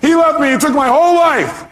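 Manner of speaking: high-pitched, wailing mock complaint; upset angry caller. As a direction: imitate a distraught woman wailing her grievance. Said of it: "because she's been angry".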